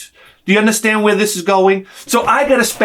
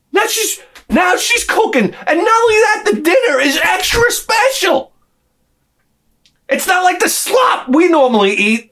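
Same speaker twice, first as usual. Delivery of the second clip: outraged ranting; 12 semitones higher than usual. Real, not acted. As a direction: rant like you can't believe it. Outraged retelling of wife's cooking.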